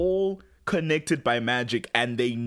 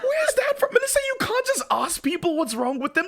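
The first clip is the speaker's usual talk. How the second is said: much higher, louder laughing outrage; shocked disbelief; 4 dB louder than usual. Real, not acted. Host reacting to Melissa's rude line, high laughing shock. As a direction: yelp it out, stunned and laughing. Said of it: "I was like".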